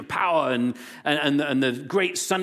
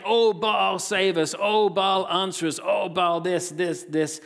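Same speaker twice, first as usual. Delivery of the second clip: mock pleading cry; 6 semitones higher, slower than usual. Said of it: "they cry out to Baal".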